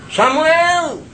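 high, loud call of a name; calling voice of God. Retold or imitated. God's voice calling the sleeping boy, high and loud. Voice calls Samuel again.

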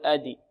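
أدي released with an i; wrongly leaning to kasra. Shown as a demonstration. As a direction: show the error: 'adi' for أدي. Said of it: "ولا نقول".

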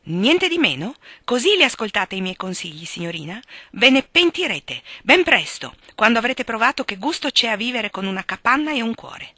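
put-on offended old lady scolding; indignant old aunt. A narrator's reading. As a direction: threaten her with regret.

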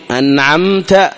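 أنعمت slow with a short closing of the lips on the meem; short clear meem. Shown as a demonstration. Teacher shows how the meem is pronounced, short and clear. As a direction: close the lips briefly on أنعمت. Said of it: "أيضًا الميم في أنعمت".

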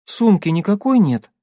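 pleading little question; plaintive. Acted. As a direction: ask it plaintively, a touch helpless. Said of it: "жалобно спросила Катя". (жалобно)

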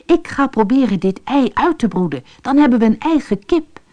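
eager, rising with hope; dreaming aloud of owning a hen; excited. A narrator's reading. Rashid tells his wife his plan, excited. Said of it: "riep hij opgewonden".

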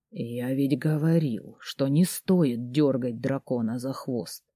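low, slow hoarse whisper. Acted. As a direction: rasp it low and slow against her lips. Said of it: "хрипло прошептал он мне в губы".

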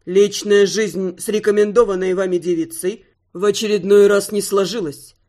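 low, put-on grieving aristocrat; mock-mournful lord voice. Male client character voiced by narrator.

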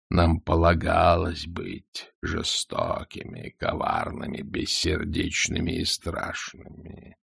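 slow, low, hushed menace; menacing dragon. A narrator's reading. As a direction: drag it out, deep and cold.

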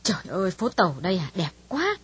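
mild wow at the sights; understated delighted gasp; admiring exclaim. Acted. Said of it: "suýt xoa kêu lên". (suýt xoa nhẹ)

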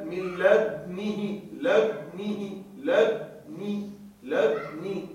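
"لدنه" drawn out very slowly, syllable by syllable; slow recitation demo. Shown as a demonstration. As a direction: repeat "لدنه" slowly with ishmam on the dal. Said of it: "يقرأ". (نطق "لدنه" ببطء شديد مع الإشمام)

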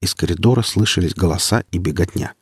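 usual speaking voice

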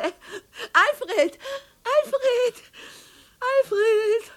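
calling high and tearful, then fading away; desperate calls dying to a murmur; calling after him. Acted. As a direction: call his name as he goes, giving up. Calling after departing husband.

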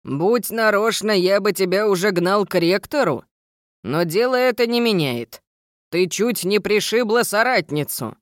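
gruff stern gnome teacher. Acted. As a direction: lecture like a strict gnome.

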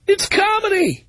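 lightly voiced indignant comedian; mock Michael Richards. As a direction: play the comic shrugging it all off, mockingly. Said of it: "Why didn't Michael Richards put out a statement like that".

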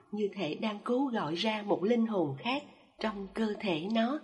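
ordinary delivery

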